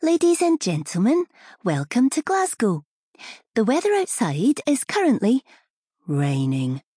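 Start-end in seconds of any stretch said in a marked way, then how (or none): 0.0-5.4 high, lilting cabin announcement; chirpy flight attendant singsong, pitched high; sing-song announcer voice. Acted. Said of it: "The flight attendant had one of those sing-song voices".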